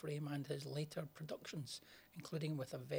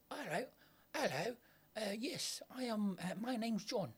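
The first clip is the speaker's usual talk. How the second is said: bad Cockney accent; 8 semitones higher than usual. Scottish host mocking bad Cockney accents, high-pitched. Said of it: "worst attempt at a Cockney accent".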